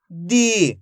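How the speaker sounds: "दी" with the ee held long; "दी" as one long, falling syllable; drawn-out long vowel. Shown as a demonstration. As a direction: stretch the vowel in "दी". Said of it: "खींच के उच्चारण करेंगे".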